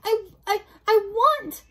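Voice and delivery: high, loud, broken wail; sobbing, stammering. Acted. Mig struggles to say her wish, acted stammering sobs. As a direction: choke on the start, then wail. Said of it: "sobbed Meg".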